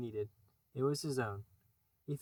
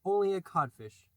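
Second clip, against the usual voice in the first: taunting; 5 semitones higher, 7 dB louder than usual. Acted. Peter taunts Hook.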